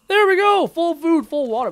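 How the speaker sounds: high, loud burst of excitement; real excited cheer; triumphant. Real, not acted. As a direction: celebrate it loudly, coming down from a high start. Celebrates full food and water in game.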